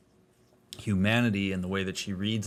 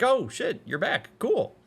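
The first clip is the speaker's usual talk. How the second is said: high, pleasantly surprised exclamation; re-enacted surprised reaction; 13 semitones higher, 4 dB louder, slower than usual. Streamer re-enacts chat's reaction to Harmon returning, pleasantly surprised. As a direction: act out delighted recognition, pitched way up. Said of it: "probably the same reaction that I had".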